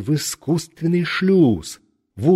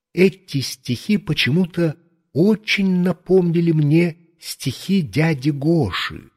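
plain reading voice

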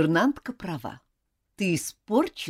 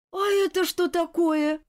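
put-on high surprised girl; 12 semitones higher than usual. A narrator's reading. Girl sees the stockings for school, surprised. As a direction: react with astonishment. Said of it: "удивилась Элизабет".

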